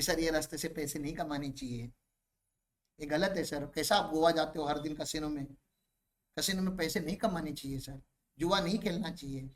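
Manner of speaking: low, soft sermon to a client; hushed, low-pitched mock ethical advice; mock moralising advisor. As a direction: imitate a meek accountant moralising, voice dropped low and quiet. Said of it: "तुम उसको नैतिक चीजें मत समझाने जाओ".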